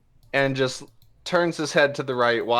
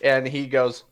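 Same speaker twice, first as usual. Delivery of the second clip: normal talking voice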